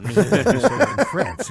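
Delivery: laughing high and loud, slowed; amused, through laughter; high, loud laughing remark. Real, not acted. Laughing about French stage women.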